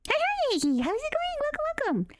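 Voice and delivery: high, sing-song welcome; jittery, squeaky greeting; too much caffeine. Real, not acted. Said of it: "I'm caffeinated right now".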